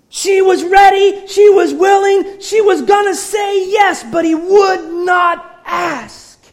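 building sermon shout; rising preaching cry. Acted. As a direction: hammer the story home. Preaching climax, loud.

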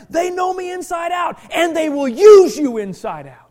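exhorting preacher, building to emphatic shouts; full-force preaching. Real, not acted. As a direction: drive each point home to the congregation.

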